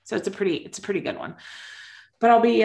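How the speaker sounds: ordinary talk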